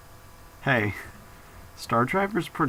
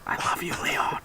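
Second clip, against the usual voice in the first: whisper; 3 dB quieter than usual. Pretend. Teasing whisper to Leon.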